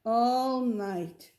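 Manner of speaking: plain reading voice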